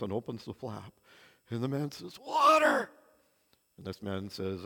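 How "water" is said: high pleading cry for water; desperate cry. Retold or imitated. Crawling man begs the Bedouin for water, desperate cry. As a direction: croak it out, desperate.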